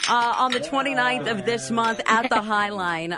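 normal talking voice

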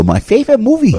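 high put-on Japanese accent; exaggerated Japanese accent; Japanese accent impression. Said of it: "that's an impression".

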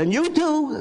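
high, firm mother voice; mother's voice. Retold or imitated. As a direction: mom insisting playfully. Mother ordering son too.

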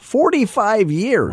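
high, slow, drawn-out disbelief; amazed. Real, not acted. Echoing the shoe shine man's answer to the radio cohost, amazed. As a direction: stretch it out in wonder at the number.

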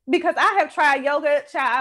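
ordinary talk, nothing marked

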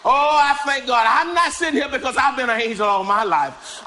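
usual speaking voice

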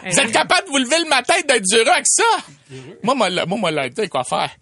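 high whiny cynical rant; grumpy negative guy. Acted. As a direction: rant like the most negative man alive. Plays negative character Alain.

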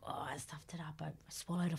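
hushed whisper; disappointed whisper after a bad audition. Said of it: "everyone's like".

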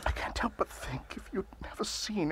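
guilty whisper. Acted. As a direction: confess it in a breath. Guilty confession to a portrait.

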